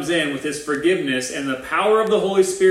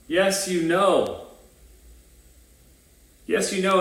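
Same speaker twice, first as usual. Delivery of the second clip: mild exasperation, higher on "know"; acted frustration; 4 semitones higher, slower than usual. Answering a spouse who keeps asking, mildly frustrated. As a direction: insist with a touch of frustration. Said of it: "Wouldn't you be frustrated?".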